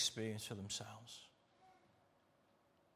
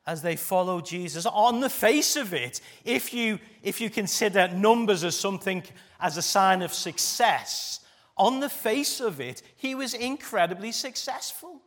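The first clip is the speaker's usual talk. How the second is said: rising, loud, fervent preaching; 8 semitones higher, 9 dB louder than usual. Acted. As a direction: preach it out with full force. Preaching builds in force.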